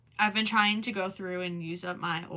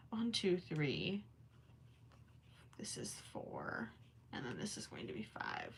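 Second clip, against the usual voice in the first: whispered counting; 8 semitones lower, 12 dB quieter than usual. Real, not acted. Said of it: "Sorry, I'm counting".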